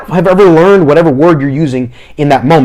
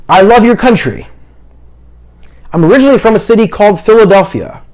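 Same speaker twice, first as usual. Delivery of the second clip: higher-pitched demo, pausing between sentences; short phrases; 5 semitones higher than usual. Shown as a demonstration. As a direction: leave room for the translator after each phrase. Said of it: "I wanna be talking in short phrases".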